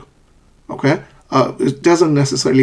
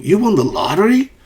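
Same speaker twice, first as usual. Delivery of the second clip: high, surprised question swooping up on "lottery"; happy disbelief, pitch climbing on "lottery"; excited rising intonation; 7 semitones higher than usual. Shown as a demonstration. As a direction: end "lottery" on a big rise. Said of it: "Rising intonation".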